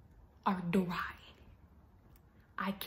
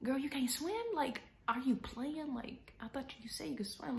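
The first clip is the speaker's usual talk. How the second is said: higher-pitched, imitating a puzzled lifeguard; confused disbelief; 6 semitones higher, 3 dB quieter than usual. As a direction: question her as if baffled, retold. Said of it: "looking at me confused".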